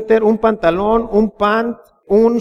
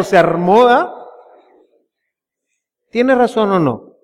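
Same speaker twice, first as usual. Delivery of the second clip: normal talking voice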